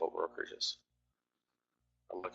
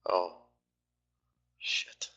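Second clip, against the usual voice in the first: a curse whispered under the breath. Real, not acted. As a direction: swear under your breath at the screen.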